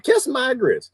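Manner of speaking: lightly imitated sassy catchphrase, pitched high; high mock retort; Aunt Esther. Host quoting a TV aunt's comeback, lightly imitated. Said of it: "Aunt Esther, you talk".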